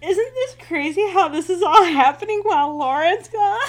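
a high, laughing imitation of the dazed girl; laughing quote. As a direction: giggle through her line. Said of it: "looks like she's about to cry".

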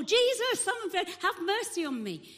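very high, loud acted shout. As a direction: escalate into an urgent yell. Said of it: "So he shouts more".

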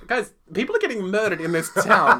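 loud mock-outraged appeal with a laugh in it; exasperated plea. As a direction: plead with everyone to notice, half laughing. Acting out exasperated plea about plot.

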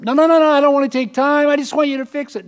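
whining at the builder to just fix it; mock whine. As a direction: play a spoiled impatient petitioner. Voicing impatient complainer.

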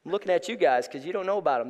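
ordinary talk, nothing marked

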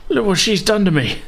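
high, pleading bandit voice, breaking into desperation; begging wounded bandit. Acted. Wounded bandit pleading with Ruby for his life. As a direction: beg for your life, terrified and hurting.

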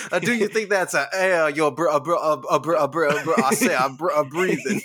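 drawling rooster voice, stuttering; Foghorn Leghorn. Retold or imitated. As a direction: ham up the cartoon rooster. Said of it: "it's a foghorn leghorn".

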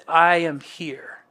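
slow, hushed near-whisper; God's convincing voice. Acted. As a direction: whisper it slowly as quiet reassurance. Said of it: "God's convincing voice".

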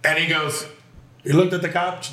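ordinary talk

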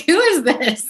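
high, incredulous who's-this; mock bafflement. Boyfriend on the phone hearing her Southern accent, baffled.

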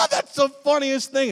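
high, loud burst of mock laughter; hammed-up laughing. Viewer cracking up at the cat video, hammed-up laughing. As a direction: crack up loudly, then talk high.

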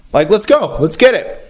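high, fired-up self pep talk; psyched-up. Retold or imitated. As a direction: hype yourself up to get going. Psyching himself up to record.

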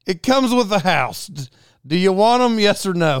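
exasperated, demand a yes or no; impatient call. Retold or imitated. Impatient reply shouted to buyer.

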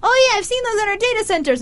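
suddenly recognising the thing; bright recognition. Retold or imitated. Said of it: "They're like".

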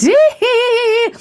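squealed, high-pitched "hee-hee"; high giggly squeal. Real, not acted. Host joking she is dynamite, high giggly squeal. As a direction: let out a high, playful giggle.

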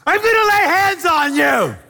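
high, loud shout into her ear. Retold or imitated. Preacher shouting over a church full of tongues. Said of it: "now I'm shouting at people".

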